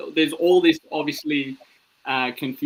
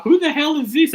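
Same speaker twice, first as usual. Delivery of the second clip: high, loud mock bafflement; exasperated teacher; 14 semitones higher, 7 dB louder than usual. Teacher stumped at roll call, loud mock exasperation. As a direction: burst out as a stumped teacher, pitched way up.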